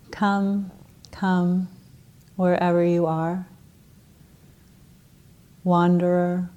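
measured meditative recitation, long pauses between phrases; unhurried poem with spacious silences. Acted. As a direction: recite it contemplatively, letting each phrase settle. Recites a Rumi poem from memory.

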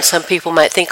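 ordinary talk, nothing marked

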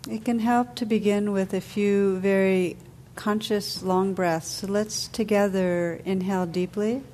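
calm, unhurried meditation voice with long pauses; guided meditation. Real, not acted. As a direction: guide listeners gently into stillness, letting phrases settle.

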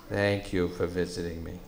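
laughing buffalo voice; huge snorting bison. Acted. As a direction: speak through a beastly chuckle. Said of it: "said the buffalo".

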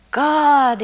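calling out for God; shout. A narrator's reading. Atheist hanging from a cliff, shouting for God. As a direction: cry out to the sky. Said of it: "he shouted with all his might".

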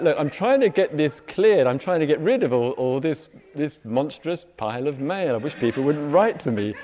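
half-laughing yell of a harried man; retold shout. He shouts down to his partner about letters, loud and exasperated. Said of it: "And I shout out".

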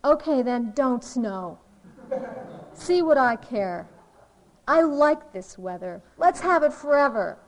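loud mock defiance; Calvin defiant. Cartoon kid telling the sky he doesn't care, loud mock defiance. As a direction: punch out each short phrase in pretend indifference. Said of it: "he looks up and he says".

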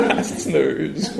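laughing through the word, drawn out slowly. Real, not acted. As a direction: let the word dissolve into a laugh. Laughing at own odd word.

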